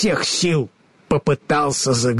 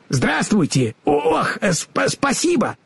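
flustered scarecrow; 8 semitones higher than usual. A narrator's reading.

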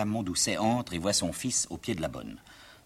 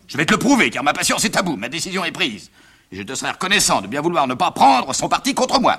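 indignant father; 8 semitones higher, 10 dB louder than usual. Acted. Plays the angry father.

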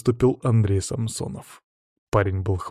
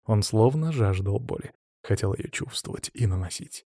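usual speaking voice

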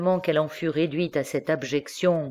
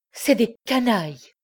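higher, sharp defiant cry; defiant outburst; 7 semitones higher than usual. Acted. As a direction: fire back at him, eyes blazing. Daughter lashes back at father.

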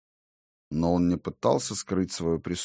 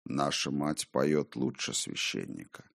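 disembodied voice; 5 semitones lower, 8 dB quieter than usual. A narrator's reading. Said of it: "сказал голос".

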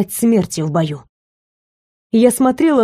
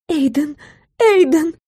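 rising desperate call, higher each time; 7 semitones higher than usual. A narrator's reading. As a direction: call his name, pleading, climbing up. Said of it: "позвала я мысленно".

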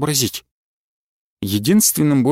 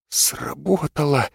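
slow, shaky relieved whisper; trembling whisper; 6 dB quieter, slower than usual. Acted. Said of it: "дрожащим голосом прошептал".

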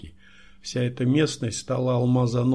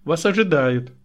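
higher, louder announcement; lightly acted, pitched-up secretary voice; chirping secretary; 5 semitones higher, 6 dB louder than usual. A narrator's reading. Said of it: "защебетала секретарша".

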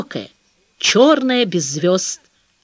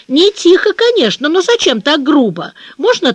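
put-on indignant child; 6 semitones higher, 4 dB louder than usual. Child objecting to mother.

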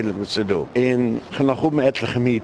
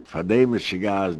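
ordinary talk

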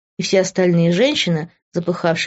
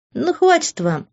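much higher, lightly amused protest; amused scoff; 11 semitones higher, slower than usual. A narrator's reading. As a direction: brush them off with a chuckle in mind. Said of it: "усмехнулась я". (с лёгкой усмешкой)

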